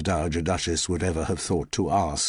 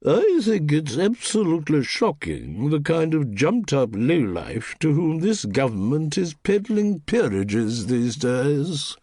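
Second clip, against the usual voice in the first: put-on raised, grand old aristocratic lady, slowing; a perfect copy of the Dowager's haughty tone; impersonating the Duchess; 6 semitones higher, 3 dB louder than usual. Housemaid mimicking her employer's voice, grand and haughty. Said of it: "which vanished as she continued".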